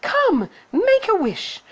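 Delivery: very high, slow, lilting voice; high-pitched, drawn-out invitation; fairy crying out. A narrator's reading. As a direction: coax it like a fairy godmother. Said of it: "she cried".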